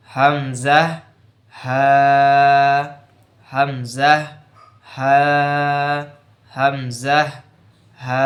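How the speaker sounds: Arabic hamza and ha, slow practice; Arabic throat letter demo. Shown as a demonstration. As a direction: sound হামজা from the start of the throat. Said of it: "হাল্কের শুরু হইতে".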